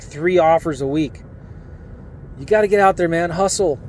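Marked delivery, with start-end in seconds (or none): none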